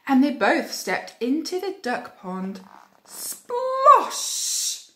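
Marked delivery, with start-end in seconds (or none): 3.0-4.9 high, loud drawn-out splosh; exaggerated splash sound effect; big splash. A narrator's reading. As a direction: burst it out like a fall into water. Narrator acts the splash.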